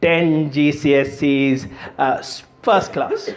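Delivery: slow, boastful Indian uncle; proud uncle on the phone, Indian accent. Acted. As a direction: brag word by word in an Indian accent. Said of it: "this is how they talk genuinely".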